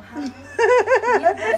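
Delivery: high, loud cackling laugh; loud burst of laughter. Real, not acted. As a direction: let the laugh explode. Old woman bursts out laughing.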